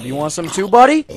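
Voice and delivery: rising to a high, loud taunt. Real, not acted. Gamer taunting an approaching mob, high loud squeal. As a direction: goad the enemy, climbing to a squeal.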